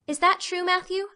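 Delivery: put-on high, rising girl's question; Anne, inquisitive. Anne asking Matthew about courting rules, high inquisitive voice. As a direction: ask brightly up high, wanting to know.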